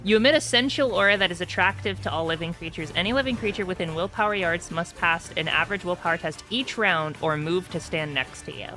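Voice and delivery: usual speaking voice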